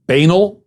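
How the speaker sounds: "Banal" as BAY-nul, rising like a question; imitated mispronunciation. Host questions the politician's word, mispronounced as BAY-nul. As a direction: query "Banal" with stress on a long BAY. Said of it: "what was that?".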